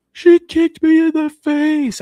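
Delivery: loud, very high, drawn-out mock wail; screaming, crying child. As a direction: imitate a little kid bawling out a complaint. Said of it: "Cal screaming and crying".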